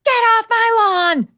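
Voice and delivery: high-pitched, drawn-out mock yell. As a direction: imitate a crotchety president yelling, stretching it out. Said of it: "In private, he's prone to yelling".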